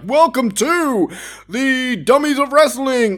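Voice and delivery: high, slow mock announcer intro; grand announcer voice. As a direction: ham up a big show welcome. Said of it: "some big elaborate intro like".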